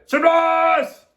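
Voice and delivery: loud, high party cheer; group shout. A narrator's reading. All the ladies greet the guest, shouting. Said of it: "all the ladies shouted".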